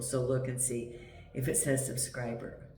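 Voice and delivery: ordinary talk, nothing marked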